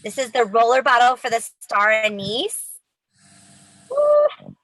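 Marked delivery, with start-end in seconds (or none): 3.9-4.5 high excited whoop; excited cheer. Real, not acted. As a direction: whoop it up for the winner. Cheer at wheel result.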